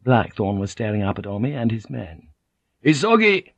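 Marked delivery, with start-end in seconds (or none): 2.8-3.5 loud, high-pitched barked command; barked order. A narrator's reading. Omi repeating his order to the prisoners, barked. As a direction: bark the order sharply. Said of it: "Omi barked out again".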